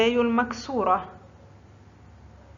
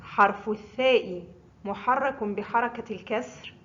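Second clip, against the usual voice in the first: ordinary talk, nothing marked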